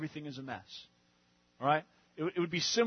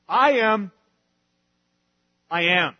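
louder voice of God; 2 semitones higher, 11 dB louder, slower than usual. Retold or imitated. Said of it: "what God is saying here is".

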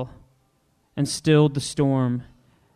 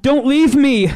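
rising higher and louder, desperate; frantic cry; 8 semitones higher, 6 dB louder than usual. Acted. Jesus cries frantically to his father from the cross. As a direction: call out to a father who won't answer. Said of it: "Jesus cried, frantically searching the sky".

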